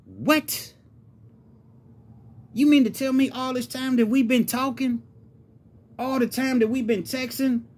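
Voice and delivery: incredulous outrage, high-pitched and insistent; indignant tirade, pitch climbing high on every phrase; furious. Acted. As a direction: demand answers in high, furious disbelief. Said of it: "Gene was furious".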